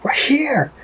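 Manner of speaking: squeaky voice for the rye announcing itself; voicing the rye. Whisky voiced as party guest, high cartoon voice. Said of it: "Just like".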